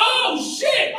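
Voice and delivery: very high-pitched startled cry; shocked. Retold or imitated. Kid reacting to mom pulling stolen glasses, shocked squeal. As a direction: let it shoot up high in alarm. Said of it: "Y'all, she was shocked".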